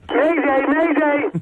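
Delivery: high loud distress call; mayday shout. Pretend. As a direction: yell it like a sinking ship. Caller shouts distress call joke.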